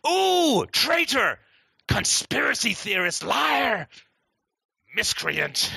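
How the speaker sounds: high, shrill-sounding "ooh" then jeering insults; mock outraged accuser. Crowd jeering at the truth-teller in mock outrage. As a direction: sneer out the names like a scandalised crowd.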